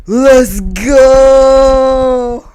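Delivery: high, loud, drawn-out victory yell; whooping celebration, held long; hyped cheer. Real, not acted. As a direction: belt it out like a fan cheering a knockout. Cheering the uppercut.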